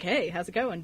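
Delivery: suave Quinn. Retold or imitated. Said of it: "he's like".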